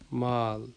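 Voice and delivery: مال with a long double a, maal; lengthened vowel. Shown as a demonstration. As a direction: stretch the a in مال. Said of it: "تھوڑا سا جو ہے وہ لمبا کرنا".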